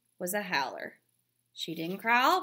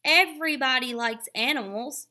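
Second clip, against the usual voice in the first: louder, higher indignant shout; 6 semitones higher, 8 dB louder than usual. A narrator's reading. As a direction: yell it back at him. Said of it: "shouted Jessie".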